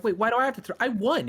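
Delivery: high, loud sisterly protest; super annoyed. Acted. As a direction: whine loudly at the unfairness of it. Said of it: "super annoyed now 'cause she's like".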